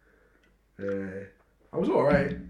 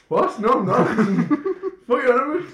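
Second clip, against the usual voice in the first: high, loud denial through laughter; mock outrage, giggling; laughing protest; 12 semitones higher, 8 dB louder than usual. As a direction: protest your innocence while cracking up. Retold denial on the phone, laughing.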